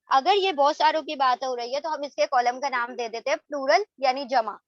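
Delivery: ordinary delivery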